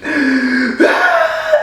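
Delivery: short, high, embarrassed laugh. Real, not acted. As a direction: laugh it off, a little sheepish. Laughs after retelling crying.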